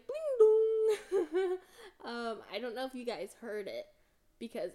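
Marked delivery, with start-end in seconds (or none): 0.0-1.6 high, loud sing-song chime; notification chime imitation. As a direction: mimic a phone notification ding. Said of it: "it popped up on my screen".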